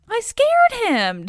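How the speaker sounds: high, drawn-out crowing; very proud. A narrator's reading. Dionysus to Xanthias after Heracles collapses, very proud. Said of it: "very proud of himself".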